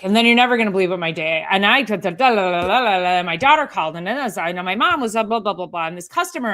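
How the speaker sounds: fast, loud babbling rant; mock a client spilling their whole day in one breath; rushed trauma dump. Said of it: "just trauma dump is what I call it".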